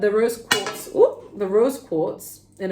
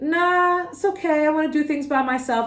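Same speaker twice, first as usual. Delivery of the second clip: higher, airy dismissive nah; breezy brush-off; 8 semitones higher, 3 dB louder than usual. Retold or imitated. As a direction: wave off the advice, lightly higher. Client brushing off advice, quoted.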